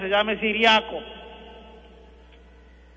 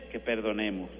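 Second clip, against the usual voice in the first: quiet, lower repeated plea; soft insistence; 8 semitones lower, 7 dB quieter than usual. Real, not acted. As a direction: let the words sink in gently. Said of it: "repeated softly for emphasis".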